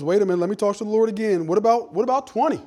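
lightly acted haggler pressing his case; Abraham bargaining. Abraham to the Lord, asking about twenty, eager bargaining.

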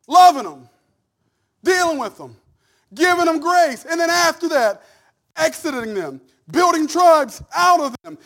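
loud, high, fervent preaching that builds; full force preaching. Acted. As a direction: preach it out to the whole church, rising.

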